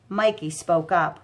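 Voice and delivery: ordinary delivery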